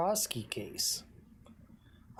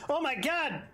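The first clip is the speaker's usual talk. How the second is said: ordinary talk, nothing marked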